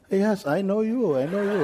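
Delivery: very high, laughing insistence; excited driver. Retold or imitated. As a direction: chuckle while insisting. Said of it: "like this".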